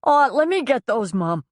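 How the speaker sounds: a high, loud plea with tears rising; choking back tears. Acted. Son offers to carry mother's suitcases, choking up. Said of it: "the sound of choking tears coming into his voice".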